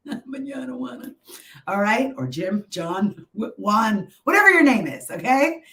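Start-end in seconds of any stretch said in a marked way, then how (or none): 0.1-1.1 laughing. Real, not acted. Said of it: "I like to say mañana juana".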